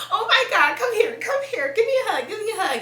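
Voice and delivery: high, loud consoling friend voice; friend comforting. Retold or imitated. Friend August consoling her crying friend, high and gushing. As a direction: imitate the friend rushing in with a hug. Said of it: "and she like".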